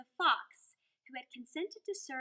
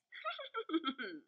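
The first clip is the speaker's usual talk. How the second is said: sly laugh; 3 semitones higher than usual. Acted. Fox laughs walking off.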